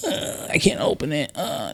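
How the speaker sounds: 软弱无力的哼唧声，音量很小; mock a kid giving up too fast; whiny child. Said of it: "Don't do one of these".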